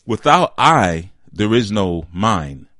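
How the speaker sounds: slow, loud stress on I and mine; slow, weighty emphasis. Shown as a demonstration. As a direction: drive the point home slowly. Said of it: "key point stressed slowly".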